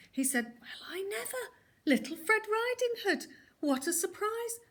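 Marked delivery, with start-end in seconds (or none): 0.6-1.4 fake surprise. A narrator's reading. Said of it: "pretending to be surprised". 1.8-4.6 high, hammed-up surprise; fake surprise. A narrator's reading. Wolf pretending to meet Fred by chance, high put-on surprise. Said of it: "pretending to be surprised".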